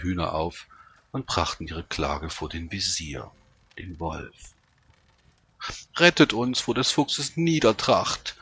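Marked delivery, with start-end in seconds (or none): 6.0-8.4 high, loud plea for rescue; urgent acted imploring; pleading hens. A narrator's reading. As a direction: beg for protection, voice raised. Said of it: "flehten sie ihn an".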